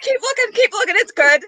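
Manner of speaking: high, giggly retelling of a tease; barely holding back laughter while quoting him; laughing through the quote. Retold or imitated. Retelling Matt's teasing reply, laughing.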